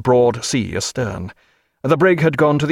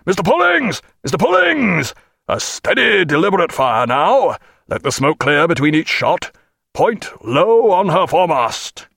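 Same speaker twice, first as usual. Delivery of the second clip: high, loud captain's call across the deck; much louder; 5 semitones higher than usual. A narrator's reading. Captain hailing his lieutenant, loud high shout. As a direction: bellow the orders to the gun crews. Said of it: "and much louder".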